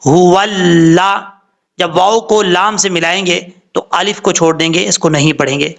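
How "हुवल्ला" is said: हुवल्ला slow and louder, lips rounded on वा, ल doubled; careful recitation. Shown as a demonstration. Teacher showing the joined word, slow and emphatic. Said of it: "वाव को पढ़ते वक्त होंठ गोल होंगे".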